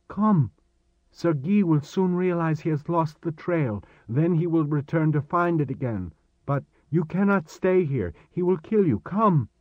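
put-on high-pitched, pressing boy's voice; urgent warning. Boy warning Chris that pursuers will return, urgent. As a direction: plead with him to flee at once.

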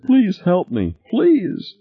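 very high, pleading, breaking sob; sobbing hysterically. A narrator's reading. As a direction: beg through tears like a child. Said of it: "sobbing hysterically".

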